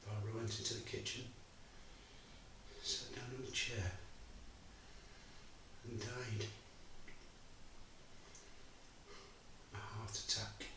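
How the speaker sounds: drops nearly to a whisper telling of her death; hushed grief. Acted. Bob telling the audience his wife died, hushed. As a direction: let the words fall away, barely voiced.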